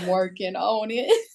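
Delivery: mock West Texas accent, playfully repeating her friend. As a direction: echo her line in a light West Texas twang. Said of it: "you have to have that West Texas accent".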